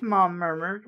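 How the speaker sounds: plain reading voice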